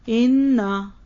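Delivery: इन्ना with a doubled, held न; Arabic इन्ना, nn doubled and stressed; Arabic pronunciation. Shown as a demonstration. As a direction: say इन्ना with the geminate nn clearly held. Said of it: "ये जो इन्ना है ना".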